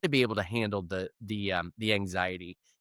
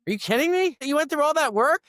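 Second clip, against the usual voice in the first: very high, louder mock outrage at a friend; exasperated disbelief; 13 semitones higher, 3 dB louder than usual. Urging a scared friend at the tower top, incredulous. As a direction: squeal out are you kidding me. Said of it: "I'm like".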